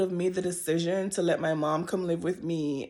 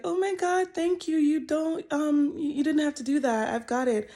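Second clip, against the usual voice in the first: much higher, bright, flustered thank-you; gushing thanks; 8 semitones higher than usual. Retold or imitated. Thanking two little girls who picked up cans, high flustered gush. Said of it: "And I was like".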